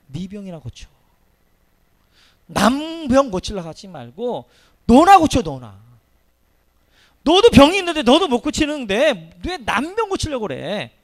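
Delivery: loud, slow jeering taunt; mocking enemies. Enemies mocking the sick apostle, loud and jeering. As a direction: jeer at him like a scornful mocker. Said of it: "바울사도를 조롱하면 이렇게 이야기합니다".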